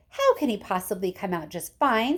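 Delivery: usual speaking voice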